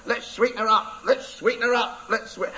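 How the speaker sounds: high squawky parrot chant. Acted. Parrot voiced in story.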